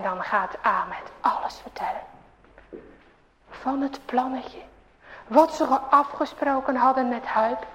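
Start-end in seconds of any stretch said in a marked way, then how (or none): none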